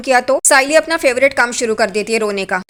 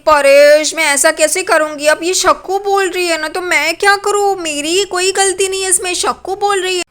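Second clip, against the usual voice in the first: higher, whiny put-on innocence; playing the poor victim; 6 semitones higher than usual. A narrator's reading. Renuka to Paresh, pleading her innocence in a pouty, whining voice. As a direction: pout and plead like you've been wronged. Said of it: "बेचारी की acting दिखा के बोलती है".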